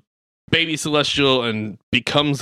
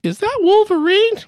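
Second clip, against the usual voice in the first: put-on very high, louder quavering old lady; 5 dB louder than usual. Old lady behind them asks, high confused old voice. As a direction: ask it like a confused old woman. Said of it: "It's like".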